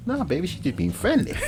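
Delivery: high-pitched, softened pleading; mock placating boyfriend. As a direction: play the boyfriend smoothing it over. Voices a man soothing his partner.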